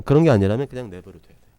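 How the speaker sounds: emphatic loud. Shown as a demonstration. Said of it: "emphatic instruction".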